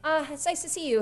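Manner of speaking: high, hesitant hello; flustered greeting. Acted. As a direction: caught off guard, shyly pleased. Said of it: "she kinda turns and goes".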